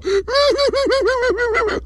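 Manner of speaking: high, hammed-up wail; exaggerated comic crying; mock wailing cry. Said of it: "started crying".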